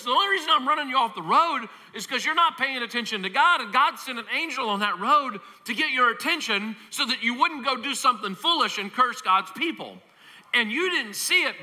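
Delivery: lightly voiced donkey setting him straight; patient talking donkey. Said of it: "And the donkey says".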